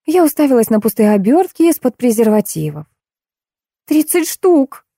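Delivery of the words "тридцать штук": high, slow, ringing exclamation. A narrator's reading. Julia announcing the wrapper count, high ringing voice. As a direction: ring it out high and drawn out. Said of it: "прозвенела Юлечка".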